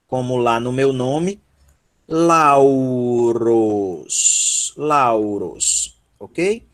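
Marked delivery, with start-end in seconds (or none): 2.1-6.0 "Lauros" with a long gliding "au"; slow model of "Lauros", AU as one glide; AU diphthong. Shown as a demonstration. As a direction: stretch the first syllable of "Lauros" from A into U. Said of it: "ó, au. Como lá no meu nome".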